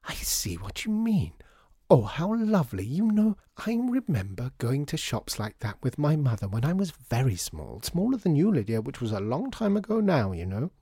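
high, swooping, delighted lady; gushing lady customer. Acted. Lady customer remembering childhood shops, gushing. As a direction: gush nostalgically with swoops up high. Voices Missus Mountjoy reminiscing.

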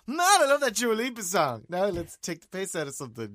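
high, loud radio-host hype; hammed-up DJ gushing; radio presenter act. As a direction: put on over-the-top on-air enthusiasm. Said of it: "go on the radio every day and go".

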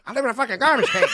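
rushed, hammed-up comic impression; Chappelle impression. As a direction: blurt out a bad impression. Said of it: "And I go".

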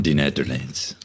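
lightly put-on Dutch accent; Netherlands accent. Guest naming where the beer is from, in a playful Netherlands accent. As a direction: try on a Netherlands accent, just for a moment. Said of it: "That was a pretty good Nether-, uh, Netherlands accent".

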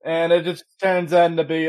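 ordinary talk, nothing marked

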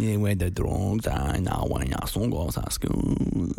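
low, slow character impression; [name]. Asked to do a TV character from Kin, low slow impression. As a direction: drag it out low, like a menacing TV character. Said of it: "Go on, [name]".

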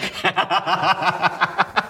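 high rapid laugh; laughing at their own joke. Real, not acted.

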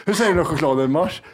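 ordinary talk, nothing marked

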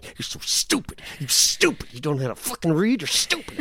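mock mean inner voice; inner critic voice. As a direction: sneer at yourself like a nagging inner voice. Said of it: "one voice is going".

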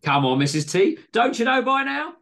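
loud, high mock exasperation; pantomime irritation. A narrator's reading. Greg ribbing Missus T over his order, mock irritated. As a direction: ham up being irritated with her. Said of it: "in a pantomime display of faux irritation".

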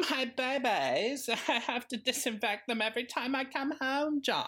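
grand dramatic lament over her wigs; hammed-up Moira; Moira impression. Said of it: "can you do a good Moira".

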